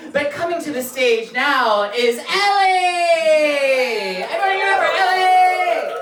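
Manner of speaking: slow then high, loud shouted introduction. Real, not acted. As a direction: bring the next comic on big. Host introduces next act.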